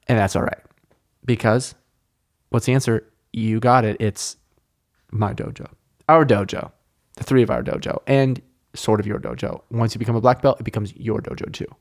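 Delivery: ordinary delivery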